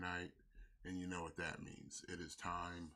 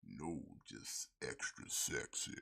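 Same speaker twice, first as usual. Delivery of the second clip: low, slow seductive voice; slow sultry purr; sexy voice; 7 semitones lower than usual. Pretend. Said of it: "talking in a sexy voice".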